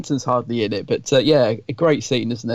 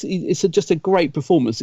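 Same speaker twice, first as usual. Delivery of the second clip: ordinary talk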